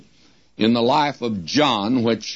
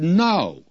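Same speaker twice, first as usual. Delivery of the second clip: higher-pitched, emphatic NO; a brief, emphatic "No"; 7 semitones higher than usual. Retold or imitated. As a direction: put firm weight on a pitched-up "No". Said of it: "He gives an emphatic no".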